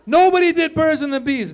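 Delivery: high, loud protest; loud, incredulous-style declaration; exclaimed outburst. Acted. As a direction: burst out high, falling away. Emphatic outburst in stand-up bit.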